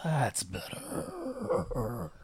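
slow, very soft villainous rasp; sinister lich voice. Acted. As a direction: savor it like a new monster. Villain's first words after transformation.

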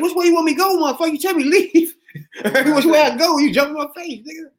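high, loud mock complaint breaking into laughter; exasperated, laughing. Walker confronting the man blocking his path, high laughing complaint.

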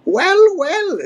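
put-on high-pitched old codger voice; crotchety old ape. A narrator's reading. Old Cranky catching Donkey Kong lazing, crotchety. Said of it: "said Cranky".

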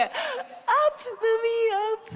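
put-on very high, whiny little-child plea; toddler. Toddler son asks his mother to pick him up, childlike plea. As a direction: imitate a small child begging to be lifted. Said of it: "my son was a toddler".

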